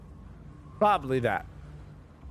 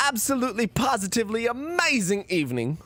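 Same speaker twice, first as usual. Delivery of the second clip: a botched, half-laughing British accent, pitched up; failed British accent; 7 semitones higher than usual. Shown as a demonstration. Streamer attempts a British sign-off for chat, badly. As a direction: try a British accent and fumble it. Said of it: "Fuck, I can't do it".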